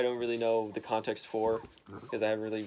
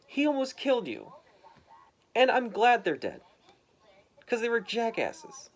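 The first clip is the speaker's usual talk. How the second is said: passionate rant; 7 semitones higher than usual. Retold or imitated. Son ranting to his dad about Daredevil, higher and heated. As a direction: build it into an impassioned defence.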